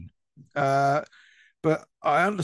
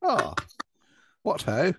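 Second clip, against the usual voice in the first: put-on posh English accent; 4 semitones higher, slower than usual. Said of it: "has a really posh voice".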